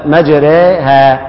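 "مجريها" very slowly, the ra vowel tilted toward e; slow teaching model of "مجريها"; drawn-out imala. Shown as a demonstration. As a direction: stretch "مجريها" syllable by syllable with a leaning ra. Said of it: "نقرأها هكذا".

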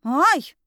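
high, loud cry of pain; sharp indignant ow; indignant yelp. A narrator's reading. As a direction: yelp in protest at being grabbed. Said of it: "возмутилась я".

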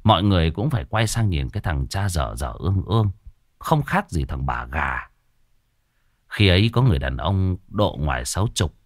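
usual speaking voice

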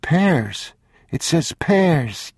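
put-on high, slow child reading aloud; young boy. A narrator's reading. Boy reading a can label to his father, high and slow. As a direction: read it out like a small boy sounding words.

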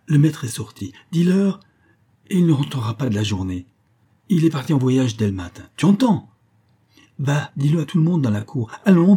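high, insistent commands building to a shout; urgent orders. Acted. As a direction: order the servant off in a hurry. Master giving maid hurried orders.

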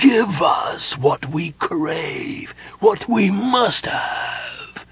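slow, high, stretched-out ghostly begging; pleading ghostly whispers. A narrator's reading. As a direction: draw out each hungry word like the dead. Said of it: "pleaded in their terrible whisperings".

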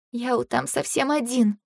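soft, slow, small voice; hushed, drawn-out sorrow; tearful worry. A narrator's reading. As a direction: say it softly, close to tears. Fay sniffles, worried for someone.

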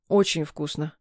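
mumbled through a spoonful; mouth full. A narrator's reading. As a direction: talk round a mouthful. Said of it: "прошамкал муж".

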